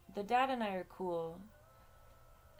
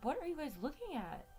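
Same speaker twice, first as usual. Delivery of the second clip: puzzled pet talk; 7 semitones higher than usual. Retold or imitated. Asking her dogs, retold.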